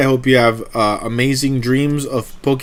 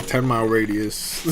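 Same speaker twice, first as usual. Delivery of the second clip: ordinary delivery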